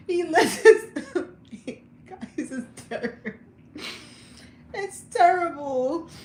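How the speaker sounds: high-pitched, helpless laughing speech; laughing hard. Real, not acted. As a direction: squeeze the words out through laughter. Cracking up over her own shouting.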